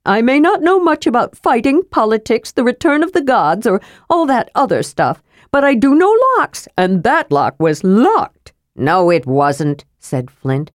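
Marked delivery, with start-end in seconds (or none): 0.1-8.5 higher, proud expert protest; kender insisting. Acted. Kender to dwarf, higher proud protest. Narrator voices Tass. 8.8-9.9 put-on lightly lower, gruff old dwarf; a grumbling tired dwarf; grumpy dwarf Flint. Dwarf Flint to kender on stairs, lightly lower and gruff.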